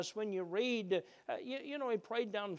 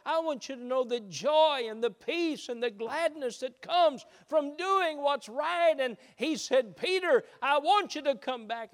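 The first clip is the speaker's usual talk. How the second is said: loud, high preacher's plea, slowing on the climax; full-force preaching; 8 semitones higher, 9 dB louder than usual. Acted. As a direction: build to a loud, high, pleading call.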